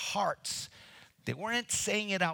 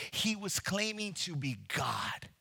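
ordinary talk

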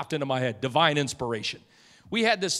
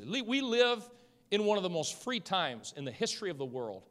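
ordinary delivery

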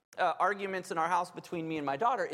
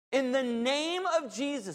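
preaching at full force; 6 semitones higher than usual. Acted. Preaching authority over Satan.

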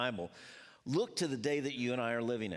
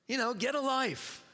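mock dismissal; 10 semitones higher, 3 dB louder than usual. Mock fans dismissing rivals.

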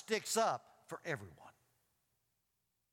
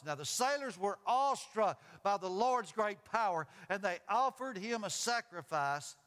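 animated Bible story, higher on the dialogue; dramatic scripture reading; 5 semitones higher, 4 dB louder than usual. A narrator's reading. Preacher reading Jonah and the sailors to the congregation, dramatic. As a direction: read aloud with weight, stretching phrase endings.

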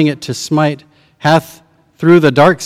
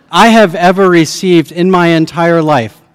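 ordinary delivery

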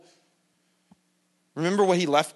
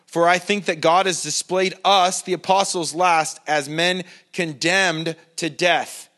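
slow, louder reading of Paul's words; preaching scripture; 5 dB louder, slower than usual. Acted. Preacher reading Paul's letter to his church, slow and loud. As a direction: proclaim it slowly, weighing each word.

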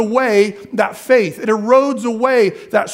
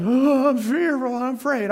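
hammed-up fear, trailing off softer; mock fearful; 4 dB quieter than usual. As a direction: imitate someone openly afraid. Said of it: "I'm not talking like".

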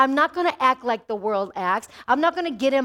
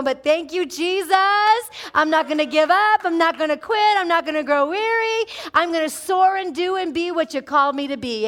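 rising bold declaration; 2 semitones higher, 4 dB louder than usual. Acted. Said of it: "Just say".

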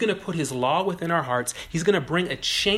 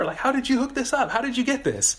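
much higher, eager amazement; amazed excitement; 9 semitones higher than usual. Pretend. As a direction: pitch it up in delighted disbelief. Amazed at better concert seats.

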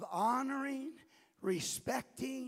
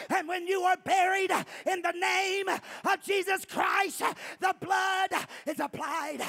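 shouting, higher pitched preaching; preaching at full force; 4 semitones higher, 8 dB louder than usual. Acted. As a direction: build to a fiery crescendo. Preaching with rising intensity.

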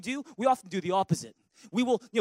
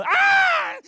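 much higher, louder startled scream; mock scream; 9 dB louder than usual. Preacher mimics someone terrified opening the Bible, mock scream. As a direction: shriek as if the Bible bites.

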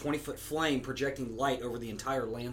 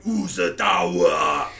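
slow, loud, far-off stone giant; booming rock monster; 10 dB louder, much slower than usual. Shown as a demonstration. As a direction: bellow it out like a rock construct. Demonstrating rock construct voice.

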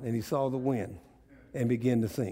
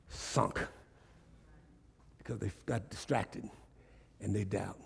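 much softer, breathy hushed sunk; hushed drop; 6 semitones lower, 10 dB quieter than usual. Acted. Preacher dropping to a hush after the climax. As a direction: let it fall to a near whisper.

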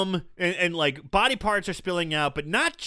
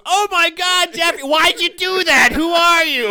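high, loud, shocked cry with a laugh; shocked shriek; 10 semitones higher, 6 dB louder than usual. Retold or imitated. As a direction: Elizabeth shrieking at the killer. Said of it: "Elizabeth goes".

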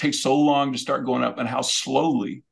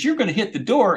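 wife's warning, higher; 8 semitones higher, faster than usual. Retold or imitated. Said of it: "And she says".

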